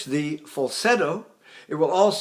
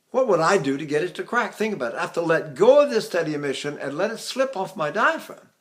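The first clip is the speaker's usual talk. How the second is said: ordinary delivery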